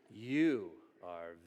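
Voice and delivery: very slow, low and soft; tender approval. Acted. God looking at you and approving, tender approval. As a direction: draw it out low, savoring each word.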